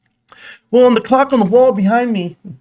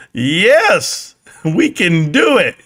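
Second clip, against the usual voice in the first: ordinary talk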